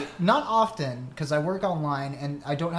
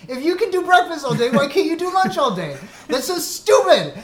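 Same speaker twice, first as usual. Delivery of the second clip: loud, high mock yell at the counter; retold angry outburst; 10 semitones higher, 8 dB louder than usual. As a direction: rant like you want to yell at the cashier. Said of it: "I really wanted to yell at the person".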